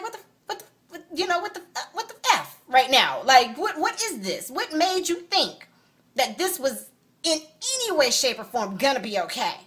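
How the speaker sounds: retold furious confrontation; angry attitude. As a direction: stammer in fury, then demand. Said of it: "I got an attitude".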